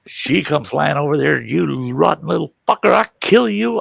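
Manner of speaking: retold sister's rage, climbing high in pitch; mock furious. Sister flying at the brother who took her dress, mock furious. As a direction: imitate an enraged sister, pitch rising to the threat. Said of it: "she was pissed".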